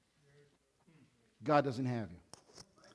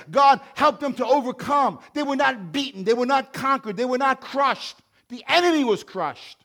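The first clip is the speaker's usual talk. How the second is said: loud, high preaching cry; shouted sermon build; full-force preaching; 7 semitones higher, 8 dB louder than usual. Acted. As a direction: preach it out with fire.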